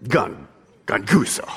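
low, soft grumble to a neighbor; demonstrating a low grumbled word. Shown as a demonstration. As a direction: mutter it low for them to copy. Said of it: "turn to your neighbor and just go".

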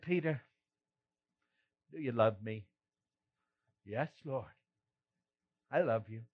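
a hushed heart-to-heart; tender and quiet. Acted. Intimate questioning by the fire.

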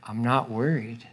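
whispered, unbothered; calm whisper. Acted. A calm believer whispering in the storm, unworried. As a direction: breathe it out, not worried at all.

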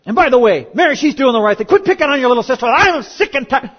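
loud, high mock parental rant; hammed-up fed-up yell, drawing out the end; exasperated scolding. Fed-up parent telling off a sister, loud mock rant. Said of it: "that would have been me".

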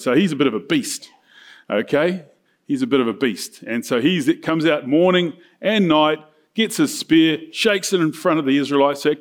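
ordinary talk

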